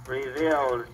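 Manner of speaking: normal talking voice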